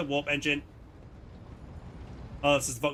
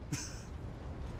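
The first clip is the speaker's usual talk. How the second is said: breathy laugh; 14 dB quieter than usual. Real, not acted. Laugh breaks into character voice.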